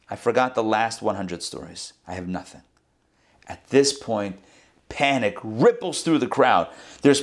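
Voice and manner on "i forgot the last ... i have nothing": sheepish confession. Retold or imitated. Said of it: "the fox turns around and says".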